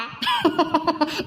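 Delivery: teasing chuckle at her expense; mocking laugh. Woman making fun of a gullible jail mate, mocking chuckle. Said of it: "ನಗಬೇಡ ನೀನು".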